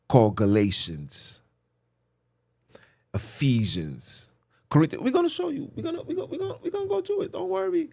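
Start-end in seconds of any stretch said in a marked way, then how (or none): 5.0-7.9 very high, squeaky reassurance; playful falsetto. Real, not acted. Preacher promising listeners he will show them, playful falsetto. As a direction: jump way up into a teasing falsetto.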